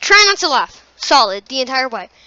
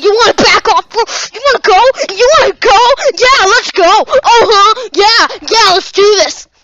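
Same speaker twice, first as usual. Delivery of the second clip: mock tough guy; 7 semitones higher, 8 dB louder than usual. Mock challenge to fight.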